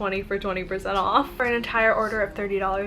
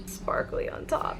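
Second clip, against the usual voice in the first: breathy whisper; 9 dB quieter, slower than usual. Real, not acted. Admiring bath bomb detail closely.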